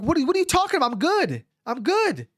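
high-pitched incredulous objection; acted-out indignant protest; retold mad protest. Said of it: "but I'm mad".

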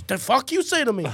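loud, high hostile snap; mock angry falafel guy; angry vendor. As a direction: bark it like an offended vendor. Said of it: "And he just goes".

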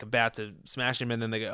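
ordinary delivery

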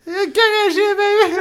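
high voice, laughing through it; hammed-up chick voice; girl voice. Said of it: "do that chick voice again".

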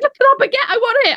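high, eager wanting voice; excited craving. Retold or imitated. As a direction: gush over the baguette.

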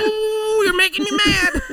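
high, laughing hammed-up menace; mock madman. Friend plays the bomber taunting, high and laughing. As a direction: taunt like a cartoon villain.

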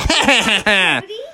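high mock-villain laugh; ogre laugh. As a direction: laugh like a gloating cartoon ogre. Ogre mocks police.

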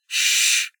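sharp breathy hush; hissed shush. A narrator's reading. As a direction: hush him fast without voice. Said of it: "Rain hissed".

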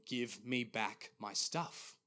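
very slow, measured demand; firm and serious. Retold or imitated. Jesus speaking to the dreamer, slow, firm and serious. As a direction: spell out the order word by word. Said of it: "quite firm and quite serious".

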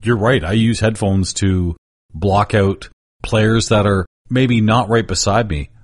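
ordinary talk

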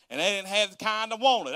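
usual speaking voice

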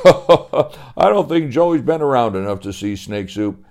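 chuckling through the line; amused at the boy; laughing. Acted. Lumberjack laughing.

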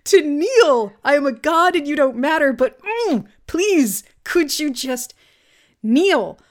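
high, slow, laughing plea; whiny pleading villain. Retold or imitated. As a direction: beg like a needy tyrant. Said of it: "he immediately says".

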